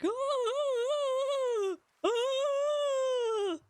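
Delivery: high-pitched drawn-out wail, mimicked; imitated wail. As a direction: wail long and high like a distant cry. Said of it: "I heard fucking wailing downstairs".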